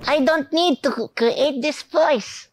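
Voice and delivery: high, slow put-on character voice; slow, high cartoon-style voice. Shown as a demonstration. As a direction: pitch it way up and draw it out. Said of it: "another character voice".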